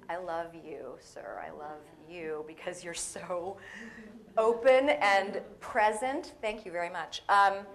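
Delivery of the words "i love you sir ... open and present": tender. Real, not acted. Tender aside to audience member.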